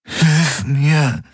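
strained, pressed out; forced out. Acted. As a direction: squeeze the words out. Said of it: "presste er hervor".

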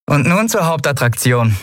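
a touch of theatrical pride; Endriel, showman. A narrator's reading. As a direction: announce it like a proud host. Endriel presenting the show.

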